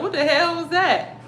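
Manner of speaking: high alarmed "what the hell was that"; startled. Retold or imitated. Feeling herself falling asleep, a startled high exclamation. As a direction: caught off guard, jumping up in pitch. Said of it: "I'm like".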